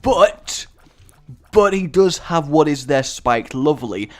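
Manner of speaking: normal talking voice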